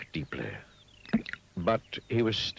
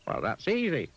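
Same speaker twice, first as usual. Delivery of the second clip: high and brightly sure; high Mister Dizzy; 11 semitones higher than usual. Acted. Mister Dizzy answers the pig, high and sure. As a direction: answer with easy confidence.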